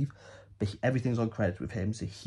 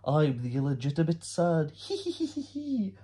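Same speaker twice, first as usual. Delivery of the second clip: gloat, then giggle like a schemer; mock villain with giggle; 2 semitones higher than usual. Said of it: "this one's like".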